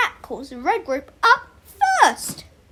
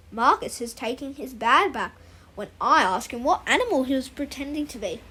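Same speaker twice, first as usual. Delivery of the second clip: ordinary delivery, nothing marked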